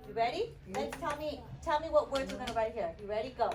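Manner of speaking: ordinary delivery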